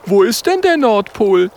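put-on high, curious young voice; Oskar asking. Oskar asking his friends where the pole is, high and curious. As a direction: ask it like a curious little porcupine. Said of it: "fragt Oskar".

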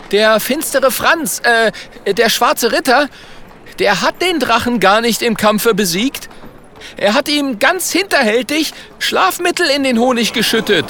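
sehr hohe, aufgeregte Figurenstimme; aufgeregt anklagender Walter. Acted. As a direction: klage hoch und empört an. Walter klagt den Ritter an. (aufgeregte Anklage mit sehr hoher Stimme)

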